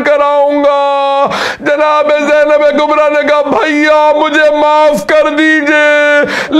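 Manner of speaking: high, strained wail of a grieving preacher, slowing; wailing preaching. Acted. Preacher lamenting Zainab's farewell to the mourners, wailing. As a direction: cry out the tragedy at full force, drawing out the words. Climax of the majlis recitation.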